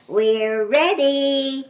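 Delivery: slow, high stretched-out call. A narrator's reading. Amelia Bedelia tells the guests they're ready, calling out. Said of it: "called Amelia Bedelia".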